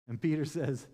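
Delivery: ordinary delivery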